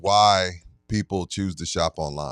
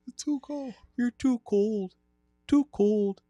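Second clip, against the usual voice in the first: mocking high character voice; 13 semitones higher, 5 dB quieter, slower than usual. Cohost mimics the high character.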